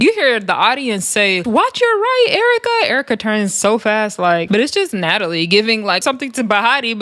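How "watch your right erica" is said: high yelled warning from the crowd; shouted warning. Retold or imitated. Audience member yelling a warning to Erica Raven, high shout. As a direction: call out the warning over the noise.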